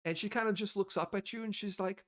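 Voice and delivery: ordinary talk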